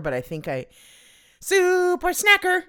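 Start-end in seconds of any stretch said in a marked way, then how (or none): 1.4-2.6 much higher, louder grand title; hammed-up announcer voice on super snacker; mock announcer. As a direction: announce it like a product name.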